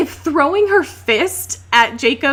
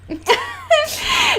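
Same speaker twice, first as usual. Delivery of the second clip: cracking up at the joke; high shrieking laugh. Real, not acted. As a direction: burst into a high squealing laugh. Laughs at her own Seth voice.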